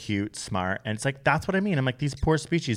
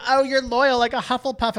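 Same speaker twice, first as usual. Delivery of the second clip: high put-on voice mimicking fans; mock the fans in a high voice; lightly mocking fan imitation; mocking quote; 12 semitones higher than usual. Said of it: "everyone's always like".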